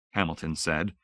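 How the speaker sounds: ordinary delivery, nothing marked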